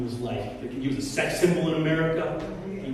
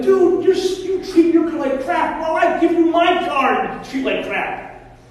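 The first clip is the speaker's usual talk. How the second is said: scolding dad; 10 semitones higher, 6 dB louder than usual. Retold or imitated. Dad scolding his son.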